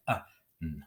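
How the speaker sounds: "Uh, n" as two separate sounds; "Uh, n" phoneme by phoneme; sounding out. Shown as a demonstration. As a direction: say "Uh", pause, then a hummed "n". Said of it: "sound out as we need to".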